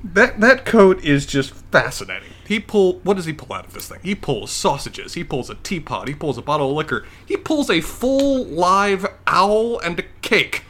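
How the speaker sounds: loud, amazed listing that builds at the end; excited gushing. Real, not acted. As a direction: marvel at each item, stretching the last one. Gushing over Hagrid's magically stuffed coat.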